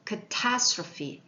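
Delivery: Catastrophe drawn out slowly; slow model word. Shown as a demonstration. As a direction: stretch out each syllable. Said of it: "읽어볼까요".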